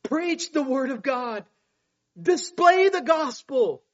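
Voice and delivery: high, loud urgent charge; urgent preacher's command, pitched high; urgent exhortation. Acted. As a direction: urge a young pastor at full voice. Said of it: "urgently, he's telling young Timothy".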